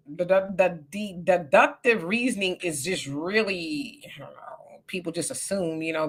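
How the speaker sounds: whispering under her breath; whispered aside. Real, not acted. Host confides her reasoning in a whisper. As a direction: let it slip as a hushed aside.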